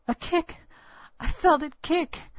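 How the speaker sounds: high, loud, thrilled exclamation; excited cry. Acted. Young mother cries out to old woman, excited. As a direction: cry out in sudden joyful surprise. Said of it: "I clutched my belly and cried".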